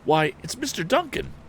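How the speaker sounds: high, startled recognition; surprised exclamation. A narrator's reading. Tom recognizing the wounded hunter, a high surprised cry. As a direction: gasp out the name. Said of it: "exclaimed the lad".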